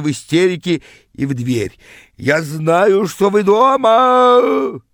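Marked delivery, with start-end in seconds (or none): 2.2-4.8 high, loud, drawn-out bellow; hysterical yelling. A narrator's reading. Frantic rival hammers at the door, hysterical yelling. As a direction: howl it, stretching the last word.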